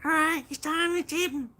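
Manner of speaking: very high squeaky voice, a joke impression. Vlogger asked by chat, squeaking high as [name]. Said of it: "I can do a squeaky voice".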